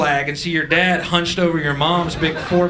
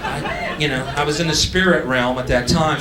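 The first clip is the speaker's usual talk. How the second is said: usual speaking voice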